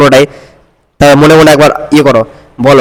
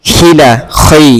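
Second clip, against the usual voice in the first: slow syllable split; much slower than usual. Shown as a demonstration. Teacher breaks the word apart.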